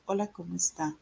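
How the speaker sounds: drained, monotone Spanish greeting; neutral tone. Shown as a demonstration. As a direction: say it with no expression at all. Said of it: "neutral tone of voice".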